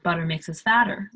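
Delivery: American accent, "butter" with a flapped T and an R, quick. Shown as a demonstration. Teacher contrasts the American version for learners. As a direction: say it the American way, T softened to D. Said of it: "like Americans say".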